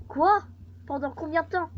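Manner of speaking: high, startled outburst; loud disbelief, then high-pitched questioning; shocked caller. Acted. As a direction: act a caller stunned by bad news. Acted caller told police will be late.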